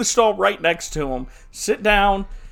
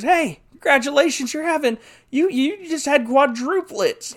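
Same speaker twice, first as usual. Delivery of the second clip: nurse; 9 semitones higher than usual. Acted. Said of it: "Nurse goes to the third man and goes".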